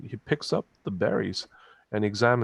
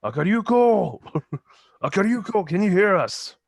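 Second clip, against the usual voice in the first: calling out yell; 9 semitones higher, 6 dB louder than usual. Acted. Ken calls after teammate who has gone ahead, yelling. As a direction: yell out as if across a distance. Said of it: "he starts yelling".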